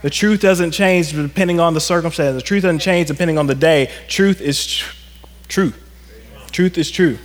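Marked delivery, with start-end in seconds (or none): 4.1-5.7 choked up. Real, not acted. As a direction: catch mid-word, then finish TRUE. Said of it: "just choked up".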